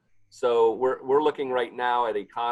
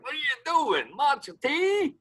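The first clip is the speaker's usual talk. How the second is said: much higher, incredulous outcry; shocked disbelief. Retold or imitated. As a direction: squeal it in mock horror. Chefs shocked at matcha salt.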